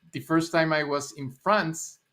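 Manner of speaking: ordinary delivery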